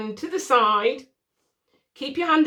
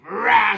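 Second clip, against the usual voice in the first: loud imitated baby roar; mock angry "rah"; angry baby outburst; 7 dB louder than usual. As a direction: burst out like a fed-up baby. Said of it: "now she suddenly said".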